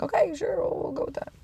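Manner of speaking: high, breathy mock "okay, sure" fading to a whisper; sarcastic agreement. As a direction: humour them with fake agreement. Mock agreeing with people's verdict.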